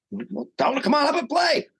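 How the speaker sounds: excited reply. Retold or imitated. Retelling his excited answer.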